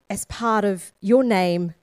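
ordinary talk, nothing marked

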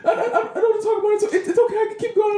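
high, squeaky mock sobbing; hammed-up crying child voice; imitating a crying kid. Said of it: "And he's crying".

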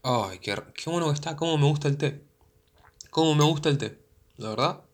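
savoring a hot tea between sips; delighted sigh after a sip; while sipping tea. Real, not acted. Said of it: "tomar un traguito de té".

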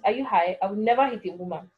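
usual speaking voice

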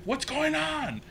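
high-pitched mock mom yell; mom's yell. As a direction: call out high like a panicked mother. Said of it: "she just yells".

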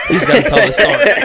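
high, loud retelling through laughter; laughing quote. Retold or imitated. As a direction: crack up while quoting her.

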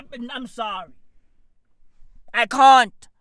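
ordinary talk, nothing marked

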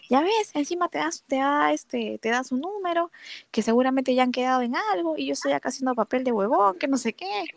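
put-on jealous annoyed boyfriend. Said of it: "recontra, recontra asado".

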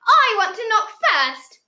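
loud, high, drawn-out demand; insistent child. A narrator's reading. Michael claims the first knock at the door, loud insistence. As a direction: demand it like a stubborn little boy. Small boy demanding his turn.

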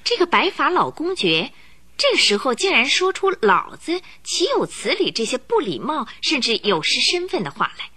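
ordinary delivery

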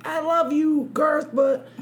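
high, mock-outraged fan voice; mocking angry fans. Mocking angry online fans, high and mock-indignant. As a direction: squeak out the fans' outrage, mocking them. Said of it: "a bunch of idiots were like".

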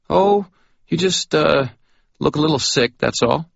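high, hesitant backpedal; awkward. Acted. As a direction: tiptoe around the insult. Harry backpedals awkwardly to drunk.

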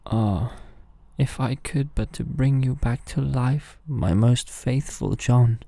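grieving king. A narrator's reading. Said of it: "full of grief he said".

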